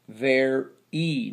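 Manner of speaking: "Ver E" in two beats ending in ee; long E ending. Shown as a demonstration. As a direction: sound out "Ver E" syllable by syllable. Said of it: "makes the long E sound".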